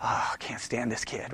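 very low, hushed grumble; muttered thought. Acted. Brothers eyeing Joseph's coat, muttered thought. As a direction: think it aloud under your breath. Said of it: "probably already thinking".

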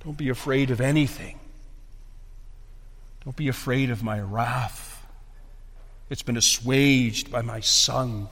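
soft, low, settling voice; gentle reassurance. Acted. God calming believers about his wrath, gentle reassurance. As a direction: drop down and reassure them quietly.

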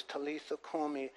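very soft, gentle murmured call; tender hush. Retold or imitated. Jesus lifting the little girl up, in a tender hush. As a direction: barely voice it, tenderly.

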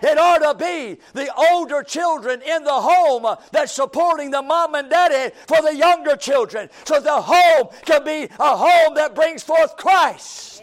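loud, lifted pulpit call; fiery preaching. Acted. Pastor telling older children to support parents, loud preaching. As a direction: drive the point home from the pulpit.

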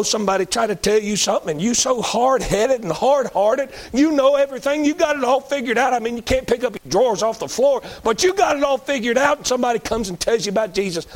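fiery revival preacher rising in pitch; preaching at full force. Acted. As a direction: preach it up to a shout. Preacher scolding the hard-headed.

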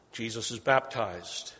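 normal talking voice

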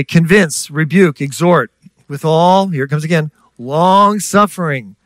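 weighty scripture reading; emphatic reading. Real, not acted. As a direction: stretch "long suffering" slowly.

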